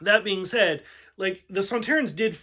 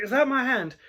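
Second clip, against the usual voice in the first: the Doctor's question; 6 semitones higher than usual. Retold or imitated. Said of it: "so he's like".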